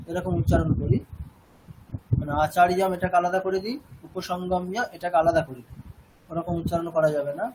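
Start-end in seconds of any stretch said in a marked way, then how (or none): none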